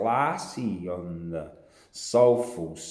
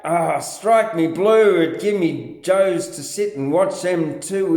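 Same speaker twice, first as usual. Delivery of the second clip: ordinary delivery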